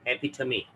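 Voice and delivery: एपिथमी said e-pi-the-mee, stress on pi; epitome said right. Shown as a demonstration. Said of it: "इसको पढ़ते हैं".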